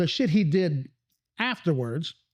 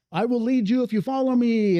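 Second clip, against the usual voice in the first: inspirational speech; 6 semitones higher than usual. Retold or imitated. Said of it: "more of just an inspirational kind of a thing".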